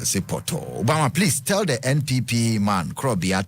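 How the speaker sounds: usual speaking voice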